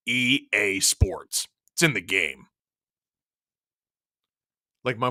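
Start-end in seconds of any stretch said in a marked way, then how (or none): 0.1-2.5 deep, slow announcer boom, lower than his own voice; EA Sports guy. Shown as a demonstration. Streamer shows off his midstream voice, deep slow announcer. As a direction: drop low and slow like the EA Sports intro. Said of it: "'Cause I can do the E A Sports guy".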